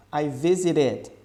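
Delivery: slow "I visited" with the E sounded; pronounced E in visited. Shown as a demonstration. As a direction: say "visited" slowly, voicing the E. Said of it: "Essa letra E aqui tá sendo pronunciada".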